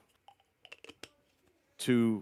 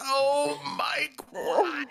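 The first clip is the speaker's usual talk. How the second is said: mock crying; 12 semitones higher, much slower than usual. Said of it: "turning your laughter into the cry".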